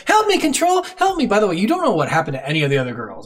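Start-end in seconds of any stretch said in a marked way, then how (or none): 0.1-1.3 high, panicked cry for help; frantic plea. Retold or imitated. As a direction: plead frantically, much higher. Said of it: "she is frantic as fuck".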